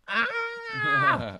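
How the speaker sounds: high startled scream; shocked scream. Acted. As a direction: recoil at ugly knees. Eliza sees her knees.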